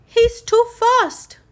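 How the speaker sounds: loud, high, out of breath; panting. A narrator's reading. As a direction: gasp it out mid-chase.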